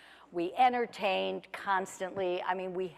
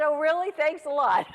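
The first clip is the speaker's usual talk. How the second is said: sarcastic; 9 semitones higher, 6 dB louder than usual. Retold or imitated. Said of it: "I said".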